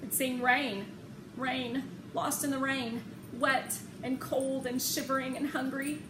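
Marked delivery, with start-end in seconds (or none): none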